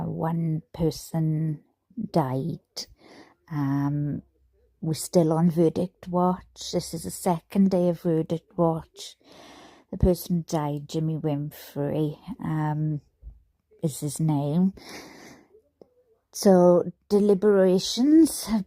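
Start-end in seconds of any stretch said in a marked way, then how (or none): none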